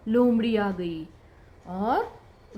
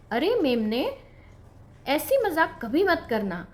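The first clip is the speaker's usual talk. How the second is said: ordinary delivery, nothing marked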